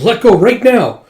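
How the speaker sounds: high, loud demand to let go; urgent yell. Acted. Character demands to be released.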